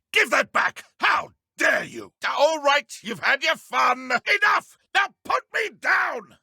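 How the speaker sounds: high outraged shouting; furious protest while held up; angry shout. Acted. Wizard lifted, scarf taken, protesting.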